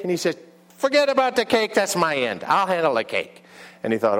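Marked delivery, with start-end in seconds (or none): 0.8-3.4 New York diner owner, starting high; New York accent. Acted. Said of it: "have a New York accent".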